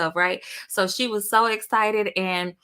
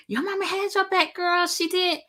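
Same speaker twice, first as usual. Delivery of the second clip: high-pitched mock viewer voice; imitating gushing viewers; 12 semitones higher than usual. Daughter quoting the viewers who praised her mom, imitating gushing voices. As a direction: echo the audience in a high sing-song pitch. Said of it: "And everybody's like".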